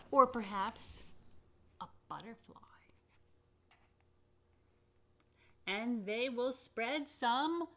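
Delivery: picture book read-aloud; reading to children. Acted. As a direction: read with wide-eyed wonder.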